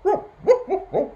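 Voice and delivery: loud, high, short woofs; dog barking. A narrator's reading. Storyteller voicing the new dog, barking. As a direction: bark like an excited little dog. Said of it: "He heard the dog barking".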